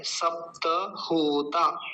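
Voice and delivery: सप्त होता slowly, sap-ta ho-taa; half प, whole त in सप्त, then stretch होता; full त. Shown as a demonstration. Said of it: "प आधा है". (सप्त होता धीरे, त पूरा)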